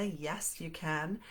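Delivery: ordinary delivery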